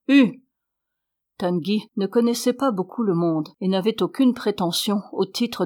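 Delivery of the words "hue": sharp higher, louder shout; shouting hue. A narrator's reading. The little man drives the armless man on with a loud shout. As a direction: crack out a command to the beast. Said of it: "en criant".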